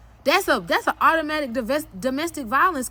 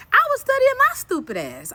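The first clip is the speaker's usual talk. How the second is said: excited; 10 semitones higher, 5 dB louder than usual. Real, not acted. Building self-mocking climax.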